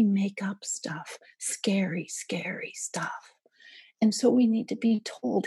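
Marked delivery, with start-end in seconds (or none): 0.0-3.3 hushed and slow, savouring the scary stuff; spooky whisper. Acted. Telling parents how brains invent fears, in a spooky whisper. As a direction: whisper it like a campfire tale.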